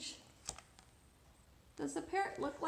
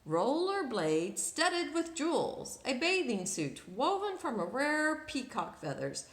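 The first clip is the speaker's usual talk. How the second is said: lively read-aloud storytelling; story narration; 4 dB louder than usual. A narrator's reading. Storyteller reading to children, lively narration. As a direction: read it like a picture book to kids.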